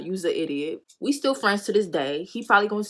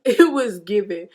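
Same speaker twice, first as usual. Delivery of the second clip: normal talking voice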